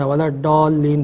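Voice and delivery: "ڈالین" with a hard retroflex d; hard Urdu D. Shown as a demonstration. Scholar quoting a variant he has heard, "ڈالین" with a hard d. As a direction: say "ولا ڈالین" with an Urdu ڈال sound. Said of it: "میں نے تو اس میں ڈال بھی سنا ہے".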